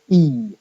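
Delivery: "E" as a long, clear ee; stressed vowel. Shown as a demonstration. Lecturer showing what stress gives you, stressed "E". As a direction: give "E" its full stressed ee sound. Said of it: "If you stress it".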